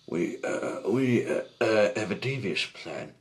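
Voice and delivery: bumbling kidnapper bluffing with false confidence; trying to sound convincing. A narrator's reading. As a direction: stall with loud ums, then announce it. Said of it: "doing his best to sound convincing".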